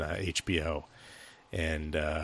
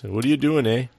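a mock Canadian accent, higher and louder, leaning on the "eh"; 5 semitones higher, 5 dB louder than usual. Shown as a demonstration. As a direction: tack a Canadian "eh?" onto the question. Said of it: "you can really isolate it to is the term "eh".

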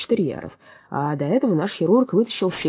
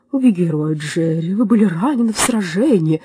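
louder, gushing turtle; admiring turtle voice; 5 dB louder than usual. A narrator's reading. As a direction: fawn over the hero.

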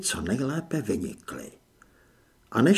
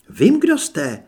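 high, loud exclamation; crying out; 9 semitones higher, 8 dB louder, slower than usual. A narrator's reading. Anežka to the advancing masked figure, crying out. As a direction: call out sharply, pitch leaping up. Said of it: "vykřikla Anežka".

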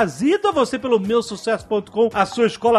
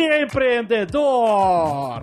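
announcer; 7 semitones higher than usual. Acted. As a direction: announce it like a big reveal. Announces sponsored segment.

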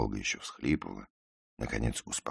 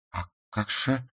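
high-voiced confusion; bewildered; 11 semitones higher than usual. A narrator's reading. Ruth cannot grasp that he dislikes her, bewildered. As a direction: protest as if lost for an answer. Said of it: "растерянно произнесла она".

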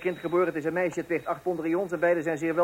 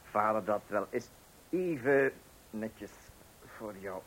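softer and lower, slowly coaxing; calm the child down gently; tender dad comforting his little one; soothing father; 7 semitones lower, 5 dB quieter, slower than usual. Acted.

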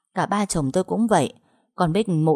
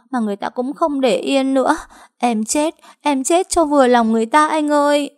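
high, wailing and louder by the end; sobbing plea; 7 semitones higher, 3 dB louder than usual. Acted. Trâm sobbing to her husband, wailing plea. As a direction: sob it to your husband. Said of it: "quay sang chồng nức nở".

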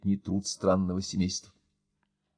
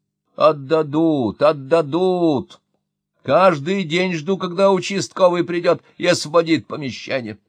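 put-on old mother lamenting; 6 semitones higher, 5 dB louder than usual. A narrator's reading. Old mother to her son and guest, resigned lament. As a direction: drag out the repeated word in weary complaint.

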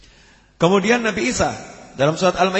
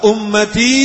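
loud, retold cry for his people; calling out; 4 semitones higher, 6 dB louder, much slower than usual. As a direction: call it out strongly, with weight. Prophet's cry, called out then softly.

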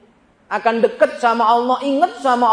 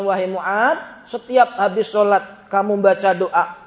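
ordinary talk

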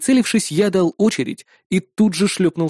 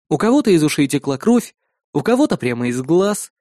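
ordinary delivery

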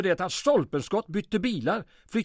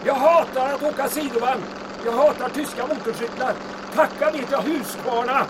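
higher, louder yell over the engine; shouting over wind; 8 semitones higher, 4 dB louder than usual. Acted. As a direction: call out above the rushing wind.

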